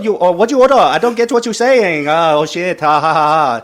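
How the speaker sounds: put-on high, loud caricatured Chinese accent; mock Chinese accent. Guest doing an accent on request, mock Chinese. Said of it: "a Chinese a little bit".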